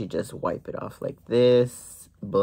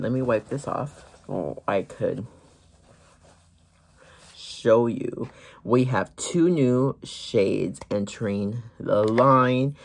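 ordinary delivery

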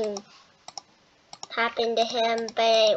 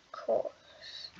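whispered; 4 dB quieter, much slower than usual. Real, not acted. Muttering after going off course.

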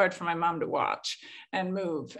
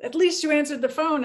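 wry relief; 7 semitones higher, 4 dB louder than usual. Retold or imitated. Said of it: "I'm like".

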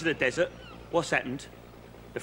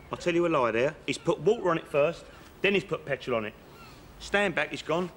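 ordinary delivery

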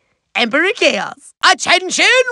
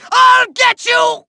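high, frustrated villain yell; furious threat; 7 semitones higher, 3 dB louder than usual. Acted. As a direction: shout a helpless vow of revenge. Villain threatens while being hit.

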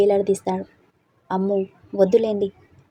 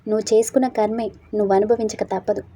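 ordinary delivery, nothing marked

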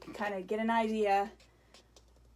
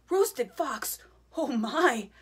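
higher, louder startled cry; alarmed fox; 4 semitones higher, 6 dB louder, slower than usual. Acted. Fox hearing he's the food, startled. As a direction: gasp it out in alarm.

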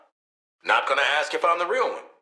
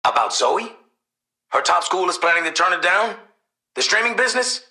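louder, pitch climbing with each question; alarmed questioning; 5 semitones higher, 5 dB louder than usual. Acted. Parent questioning news about daughter Zoe, alarmed. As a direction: fire back disbelieving questions.

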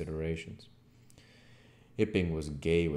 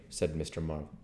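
plain reading voice

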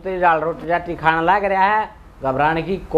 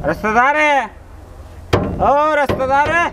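calling out; 6 semitones higher than usual. Acted. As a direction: shout the greeting from outside. Calling out at the door.

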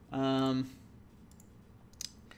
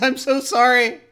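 higher, louder, hammed-up pleading; frantic apology; 7 semitones higher, 7 dB louder, slower than usual. Man on his knees to the prophet, pleading apology. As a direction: beg loudly, stretching the repeat slower. Man kneels begging forgiveness.

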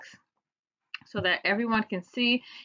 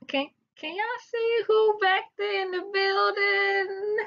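excited hype; 13 semitones higher than usual. Acted. Hyping her alter ego.

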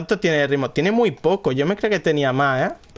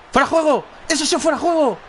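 indignant protest; 10 semitones higher than usual. Real, not acted. Gamer protesting the referee, indignant. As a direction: call out the offside.

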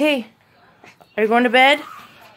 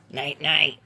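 much lower and softer night night; hushed night night; 10 semitones lower, 5 dB quieter than usual. Shown as a demonstration. Bedtime with the child, hushed and low. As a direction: tuck them in with a hush.

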